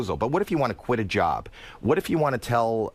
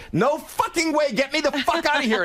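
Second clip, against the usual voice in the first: scared and angry. Retold or imitated. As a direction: refuse in a frightened rage. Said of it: "And they go".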